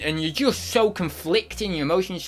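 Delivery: normal talking voice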